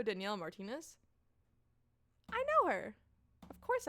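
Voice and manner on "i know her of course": very high, loud squeal of recognition; excited recognition. Acted. Kelly recognising Danielle Martinez's name, excited.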